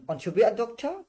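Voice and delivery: high, loud rise through "doctor"; question intonation. Shown as a demonstration. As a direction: ask it with the tune climbing to "doctor". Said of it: "আপনি প্রশ্ন করতেছেন". (প্রশ্নের সুরে, doctor-এ গলা উঁচু করে)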